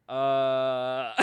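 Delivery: long, low, drawn-out "uh"; stalling. Retold or imitated. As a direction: hang on the sound, caught out. Caught out, stalling for answer.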